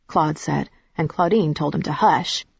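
ordinary delivery

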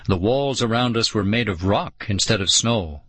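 ordinary delivery

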